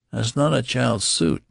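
high-pitched objection; object, pitched well up; protesting. A narrator's reading. Clerk objecting to suit for a child, protesting. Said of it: "he protested".

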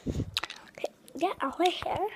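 hushed whisper. Real, not acted. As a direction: keep it to a whisper. Whispering near sleeping dad.

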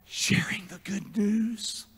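slow, hushed whisper; emphatic whisper. Real, not acted. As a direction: whisper it slowly to the congregation.

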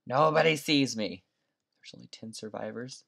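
ordinary delivery

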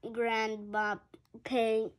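plain reading voice

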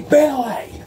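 high call across the room. Acted. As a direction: call his name out high to reach him. Calling out to Billy.

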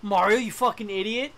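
loud exasperated yell at Mario; angry yelling. Real, not acted. Streamer yells at his own character Mario, loud exasperated. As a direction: chew him out loudly.

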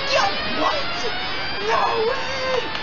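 very high squeal of disbelief; high-pitched incredulous shout; stunned shriek. Real, not acted. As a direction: shriek it in amazement. Stunned by the game.

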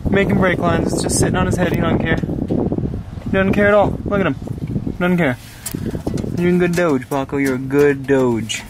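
high, sing-song doggy talk. Real, not acted. Praising his dog Paco.

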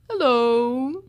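ordinary delivery, nothing marked

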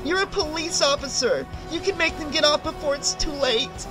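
high, anxious pleading; quivering plea. A narrator's reading. Woman begging a police officer for help, quivering. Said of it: "a slight quiver in her voice".

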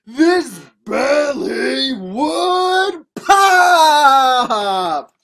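very slow, loud, stretched words; explosive climax. A narrator's reading. Hero bursting the belly, long shouted climax. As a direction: drag it out, then burst on pop.

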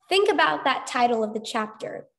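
usual speaking voice

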